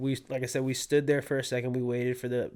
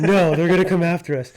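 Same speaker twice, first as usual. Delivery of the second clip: louder, laughing through a mock-scared plea; laughing mock panic; 6 semitones higher, 11 dB louder than usual. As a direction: act scared of the deer but crack up. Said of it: "I get terrified".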